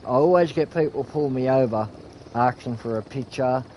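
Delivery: normal talking voice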